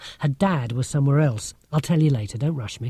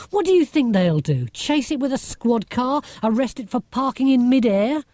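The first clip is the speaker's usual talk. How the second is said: higher, teasing sarcasm; mock the idea, about to laugh; scornful higher-pitched ribbing; 9 semitones higher than usual. Marcia mocking the police idea.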